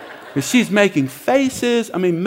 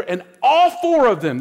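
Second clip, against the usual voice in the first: ordinary delivery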